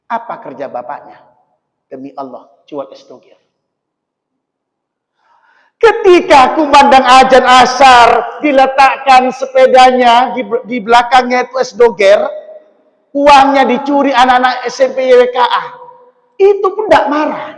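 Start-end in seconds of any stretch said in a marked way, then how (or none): 5.8-17.5 loud, high, fast sermon voice; preaching at full force. Acted. Preacher telling the congregation about the stolen money. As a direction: drive the story home with fire.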